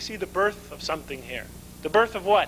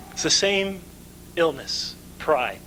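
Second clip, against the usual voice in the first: normal talking voice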